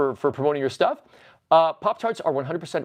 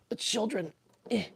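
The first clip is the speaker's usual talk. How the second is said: mock grief; 4 semitones higher, 8 dB quieter than usual. Mock grief over trapped Furbies.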